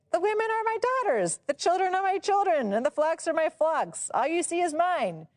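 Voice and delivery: high, fast, possessive protest; father-in-law to Jacob, high and fast; indignant Laban. Acted. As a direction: claim everything as his, rushing.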